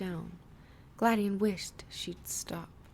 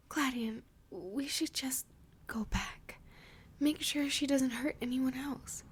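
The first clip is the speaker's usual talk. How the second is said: hushed plea; 6 semitones higher than usual. Acted. Lily pleads to go back.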